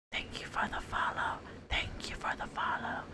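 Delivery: hushed whispered thanks; asmr whisper. Acted. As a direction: whisper it close and soft.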